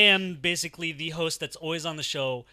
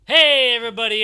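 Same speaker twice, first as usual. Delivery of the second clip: excited shout; 8 semitones higher, 13 dB louder than usual. Acted. Loud show opening.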